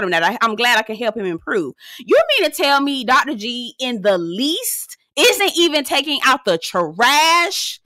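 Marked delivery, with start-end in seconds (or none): 2.0-7.8 slow, drawn-out, higher-pitched disbelief; incredulous. Real, not acted. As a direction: stretch each word in mock outrage. Disbelief at the trash clip.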